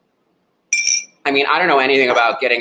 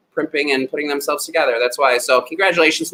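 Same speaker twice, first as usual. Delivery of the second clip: ordinary talk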